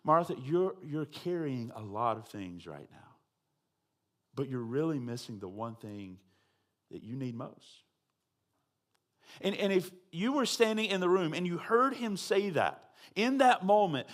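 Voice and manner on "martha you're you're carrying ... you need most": soft, lower, tender telling; gentle truth. Retold or imitated. Jesus gently telling Martha what she misses, tender. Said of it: "he gently tells her this truth".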